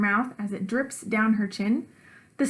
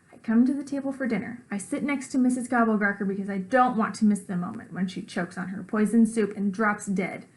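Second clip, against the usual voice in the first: usual speaking voice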